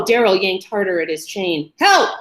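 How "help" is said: high, loud short yell; shout for help. A narrator's reading. Trapped man yelling for help again.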